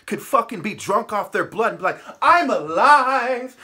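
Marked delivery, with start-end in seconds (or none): 2.2-3.5 slow, drawn-out, louder mock cry with a laugh; mocking child cheer. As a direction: ham up a giddy little kid. Mocking kids drunk on blood.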